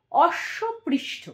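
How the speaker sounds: অশ্বপৃষ্ঠ drawn out very slowly; slow syllables. Shown as a demonstration. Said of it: "এটা কি, কি হয় অঙ্গ?". (অশ্বপৃষ্ঠ ধীরে ধীরে দলে ভাগ করে)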